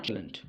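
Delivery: shalln't with an audible l, "shal-unt"; wrong two-syllable "shal-ent" for shalln't; mispronunciation being rejected. Shown as a demonstration. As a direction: sound the l in shalln't, as the mistake. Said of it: "not shalln't".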